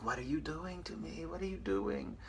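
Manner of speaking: soft, high imitated female voice; role-playing woman. Woman role-playing to her lover, mock helpless voice. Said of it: "I'm role-playing in my brain".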